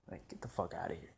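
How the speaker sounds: scornful whisper under breath. Real, not acted. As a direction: hiss it dismissively.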